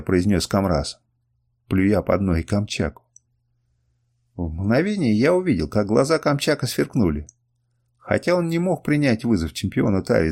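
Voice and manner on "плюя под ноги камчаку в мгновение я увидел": plain reading voice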